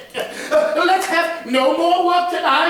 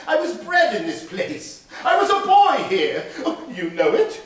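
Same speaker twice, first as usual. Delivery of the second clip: higher, louder, laughing with joy; overjoyed, bubbling with laughter at old memories; excited old man; 8 semitones higher, 5 dB louder than usual. Acted. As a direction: Scrooge delighted, laughing and crying out. Scrooge recognises his boyhood workplace.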